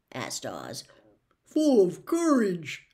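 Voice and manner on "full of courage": slow, loud, big lion voice; lion's bold reply. Acted. Lion tells Oz he feels brave, slow and loud. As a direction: boom it out slowly like a proud lion. Lion answers Oz after drinking.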